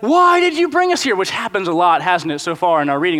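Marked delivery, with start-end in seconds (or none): 0.0-1.1 much higher, louder whiny cry; panicked Israelites. Pretend. The frightened nation crying out at Moses, high and loud. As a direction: wail it in a panic. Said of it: "they're freaking out".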